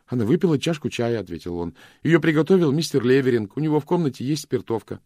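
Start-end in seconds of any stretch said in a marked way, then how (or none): 0.1-1.2 put-on higher, louder boy's voice; clear and ringing. A narrator's reading. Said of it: "Голос Джонни прозвучал отчётливо и звонко". 2.0-5.0 put-on high, loud boy's voice; clear and ringing. A narrator's reading. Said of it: "Голос Джонни прозвучал отчётливо и звонко".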